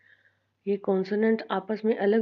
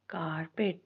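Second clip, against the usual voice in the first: Carpet hushed and softer, कार, pause, पेट; small vowel sound; 4 semitones lower, 5 dB quieter, slower than usual. Shown as a demonstration. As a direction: keep both beats of carpet small and separate. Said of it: "वो small आ रही है".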